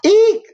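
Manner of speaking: sharp high squeal of disgust; a mock shriek at the sight; cat squealing eek. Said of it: "said Cat".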